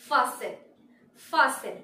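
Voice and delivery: "fasten" as FAH-sen; silent t. Shown as a demonstration. Teacher presenting the next word, "fasten" as FAH-sen. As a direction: say "fasten" without the t. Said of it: "টি হচ্ছে silent".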